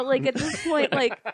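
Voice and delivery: breaking into a laugh; words caught in a laugh. Real, not acted. Son retelling the funny call, laughing through it. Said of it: "I wanna laugh".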